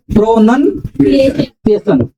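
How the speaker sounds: Pronunciation very slowly, with a gap inside; slow, split prompt. Shown as a demonstration. Teacher prompting the class to answer, pronunciation slowly broken up. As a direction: stretch pronunciation in parts for others to finish.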